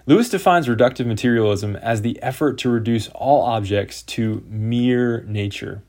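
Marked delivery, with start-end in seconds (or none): 4.0-5.8 slow, with the word mere leaned on hard; stress on mere. Shown as a demonstration. Lecturer underlining a key word for listeners, slow stress on mere. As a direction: drag out "to mere nature", punching mere. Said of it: "Emphasis on the word mere there".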